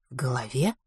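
hushed, whispered question; low, breath-only query; incredulous echo. A narrator's reading. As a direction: repeat it softly in disbelief. Doctor echoes him in disbelief.

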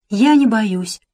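plain reading voice

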